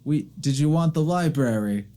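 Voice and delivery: put-on high, slow elderly temple keeper; old woman greeting visitors, high and kindly. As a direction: speak up politely as an old librarian.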